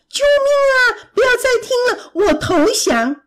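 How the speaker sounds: high-pitched panicked plea; screaming. A narrator's reading. Stung monster surrendering to the bee, screaming. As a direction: shriek for mercy, easing off at the surrender. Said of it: "怪兽尖叫着".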